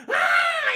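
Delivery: high, loud mock scream; imitated scream. Timothy bitten on the face, hammed-up scream. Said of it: "He's screaming, chat".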